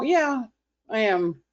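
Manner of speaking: jumps up high on yeah, then settles; cheeky admission. Retold or imitated. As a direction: own the whining with a grin. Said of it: "And you go".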